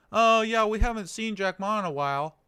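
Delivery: high, loud mock announcement; mocking news voice. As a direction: ham up the article's breezy tone.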